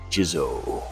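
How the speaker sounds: normal talking voice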